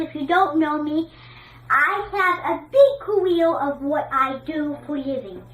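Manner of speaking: ordinary talk, nothing marked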